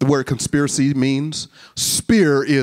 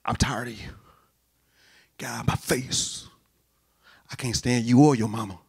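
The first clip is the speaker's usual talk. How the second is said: mock weary sigh; 2 semitones lower, 6 dB quieter than usual. Preacher voicing what Jesus never says when he sighs, mock weary. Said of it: "each time that he sighs".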